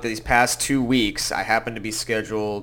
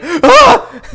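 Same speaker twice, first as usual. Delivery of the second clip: high loud scream breaking into laughter; 16 dB louder than usual. Retold or imitated. Waitress startling a coworker, laughing scream. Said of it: "like, scream".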